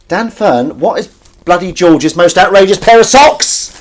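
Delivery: loud, high call to someone upstairs. Real, not acted. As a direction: shout it across the house. Calling out to partner upstairs.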